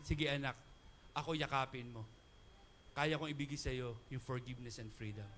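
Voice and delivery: a soft fatherly invitation; tender Lord voice. Acted.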